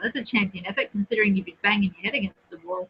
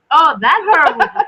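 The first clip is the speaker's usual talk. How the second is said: mock dramatic; 6 semitones higher, 10 dB louder than usual. Real, not acted. Mocking book's title.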